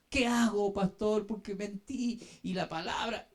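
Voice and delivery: ordinary talk, nothing marked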